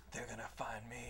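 grim whispered threat; whispered hero line; hushed whisper. Retold or imitated. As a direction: breathe it out in a whisper, no voice. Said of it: "He's like".

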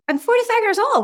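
a hammed-up high squeak of disbelief; incredulous retort. Retelling her reply to the therapist friend, incredulous high squeak. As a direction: pitch jumps way up in mock disbelief.

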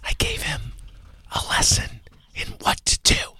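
hushed whispering host voice. Acted. Host whispering to his co-host on the Whisper Show. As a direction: keep the whole show in a whisper.

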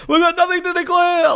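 high-pitched, gleeful quote; mock film quote. Quoting a film's one-liner gleefully.

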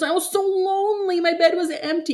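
very high, hammed-up whine; mock a self-pitying sulker; exaggerated poor-me wail; mock whiny. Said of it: "He's so whiny".

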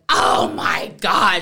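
slow exasperated exclamation; exasperated outburst. Retold or imitated. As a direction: let the frustration burst out. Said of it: "it came out of my mouth".

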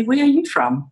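high-pitched imitation of intrigued listeners; curious question. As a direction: ask it as if struck by an accent. Retold curious reaction to accent.

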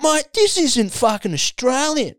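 high mock Aussie bloke rant; mocking a cranky radio caller; imitating an ocker bloke. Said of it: "And I thought".

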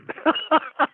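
short high-pitched laugh; a quick amused high giggle. Real, not acted. Telling the interviewer his wild story, short high laugh.

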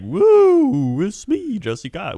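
put-on showing off as carefree teen; hammed-up carefree woo; carefree cheer. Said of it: "you were probably just like".

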